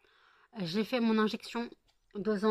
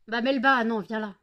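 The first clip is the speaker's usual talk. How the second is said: raised call to the pet; calling the cat; 3 semitones higher, 9 dB louder than usual. Real, not acted. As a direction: call her back sharply. (appel fort au chat)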